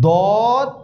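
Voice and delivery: দদ heavy, full and drawn out; thick দদ. Shown as a demonstration. Teacher showing the heavy letter, thick drawn-out দদ. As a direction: fill the mouth for a thick, long দদ. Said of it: "দদ মোটা হবে".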